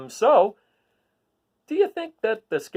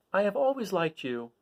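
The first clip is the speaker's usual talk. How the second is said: plain and simple, softly said; simply; 4 dB quieter than usual. A narrator's reading. Dorothy simply reassuring the Scarecrow. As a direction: say it simply, no fuss. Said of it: "said Dorothy simply".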